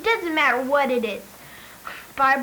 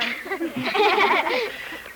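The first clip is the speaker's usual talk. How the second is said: a quick amused laugh; 5 semitones higher than usual. Real, not acted. Billy laughs after saying phones are faster, amused laughter. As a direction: chuckle at your own point.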